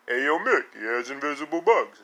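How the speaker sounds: slurred mock palooka voice; Rocky. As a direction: lightly imitate a punchy Italian-American boxer. Reprises his Rocky voice to Mick.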